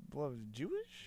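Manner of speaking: unsure, hushed question; said so soft. Real, not acted. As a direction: drop right down, doubting the word. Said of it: "That's why I said it so soft".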